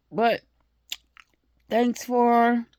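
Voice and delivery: talking with food in mouth; spitting while talking. Real, not acted. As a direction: mouth full, spitting a little. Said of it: "I'm spitting".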